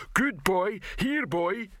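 high-pitched call to a dog; Rock. A narrator's reading. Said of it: "said the voice of Rock".

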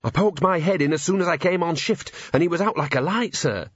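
high-pitched, dutiful report; earnest constable. A narrator's reading. Constable explaining to commander what he found, earnest.

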